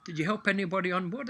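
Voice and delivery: a hint of a keen young lad asking; young apprentice. Apprentice voiced in joke.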